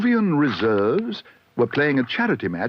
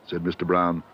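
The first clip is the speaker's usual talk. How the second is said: usual speaking voice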